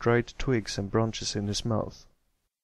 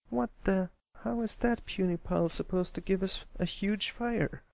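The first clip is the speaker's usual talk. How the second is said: high, bossy filly complaining; a pushy little pony scoffing at the sticks; Scootaloo, high kid voice; 10 semitones higher than usual. Acted. Scootaloo scoffing at Pipsqueak's sticks, high complaining filly voice.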